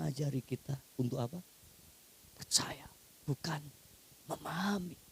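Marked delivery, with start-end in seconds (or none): 2.3-5.0 hushed, breath-only teaching line; whispered maxim. Acted. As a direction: draw them in with a whisper.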